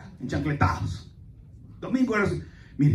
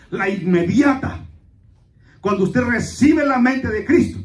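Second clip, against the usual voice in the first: raised, insistent exhortation; full-force preaching; 5 semitones higher, 6 dB louder, slower than usual. Real, not acted. Pastor insists sin must weigh on you, preaching forcefully. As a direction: press it hard on the congregation, building louder.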